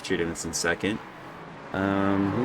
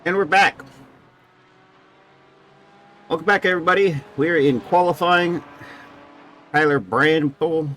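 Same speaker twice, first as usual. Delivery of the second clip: energetic comeback; 9 semitones higher, 6 dB louder than usual. Real, not acted. Commentator returns from break.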